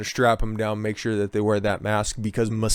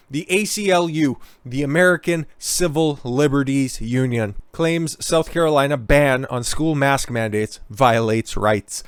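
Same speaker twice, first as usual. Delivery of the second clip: ordinary delivery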